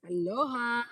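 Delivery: loud, high playful call; exclaimed greeting. Pretend. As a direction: sing out a big island hello. Calls out the patch's greeting.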